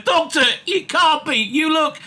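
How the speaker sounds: high-pitched, astonished exclamation; surprised. Acted. Wilfred seeing the Doctor again, surprised. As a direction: blurt it out in disbelief. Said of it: "saluting surprise".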